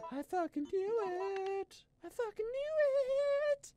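high, drawn-out squeal of triumph; shrieked triumph. Real, not acted. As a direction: scream it out like you called it.

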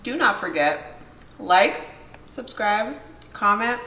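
loud call to like and subscribe; loud outro. Real, not acted. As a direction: call out to viewers.

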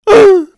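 high, loud yelp of alarm; sudden cartoon gasp; startled gasp. Villagers flee in alarm.

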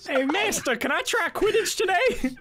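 high pleading voice. Pretend. Voices a pupil talking to the master.

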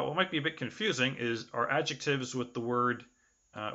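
normal talking voice

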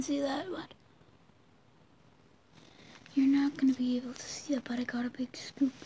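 hushed whisper to camera. Real, not acted. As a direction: keep it to a whisper.

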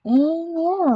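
ordinary talk

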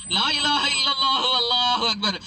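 high, loud proclamation; shouted takbir. Acted. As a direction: call it out with full force. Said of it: "இவங்க கத்த ஆரம்பிச்சாங்க".

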